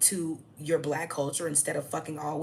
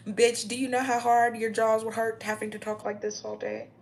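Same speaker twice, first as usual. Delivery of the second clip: higher, louder, through a stiff jaw; mock bad-teeth voice; 6 semitones higher, 5 dB louder than usual. Shown as a demonstration. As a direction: keep mimicking her strained mouth.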